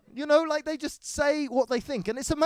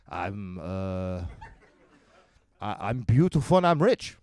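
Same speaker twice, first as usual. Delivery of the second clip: low, halting self-appraisal; ponderous Swede. Acted. Big Swede answering a greeting literally, low and pondering. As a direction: ponder yourself slowly, deep in the voice.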